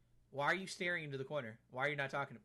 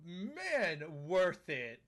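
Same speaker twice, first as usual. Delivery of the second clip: slow, drawn-out mock admiration; mock dreamy character; 3 semitones higher, 4 dB louder, much slower than usual. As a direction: drag out the view like a dazed fan. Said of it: "and he goes".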